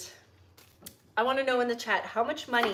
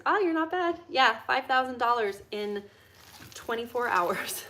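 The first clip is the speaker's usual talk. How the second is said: ordinary delivery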